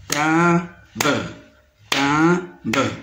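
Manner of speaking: TIMBRE split in two, tim, then bre; teacher beating out both syllables of TIMBRE. Shown as a demonstration. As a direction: pause inside TIMBRE, voicing the final bre. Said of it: "deux syllabes".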